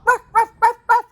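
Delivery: high yapping bark; silly high ruff ruff; yappy dog bark. Retold or imitated. Imitates dog barking.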